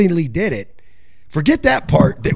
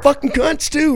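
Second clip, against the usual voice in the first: gleeful villain voice; 10 semitones higher than usual. Retold or imitated. Mocking the fraudsters' gloating.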